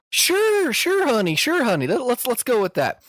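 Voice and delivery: high-pitched granny fumbling with her computer; Southern old lady. Acted. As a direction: play dumb like a sweet old grandma. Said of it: "pretended like I was a, just a Southern idiot".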